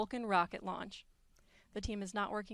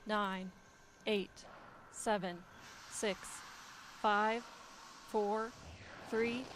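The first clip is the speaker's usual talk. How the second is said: countdown. Real, not acted. Host reading final launch countdown.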